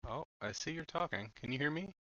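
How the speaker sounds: ordinary talk, nothing marked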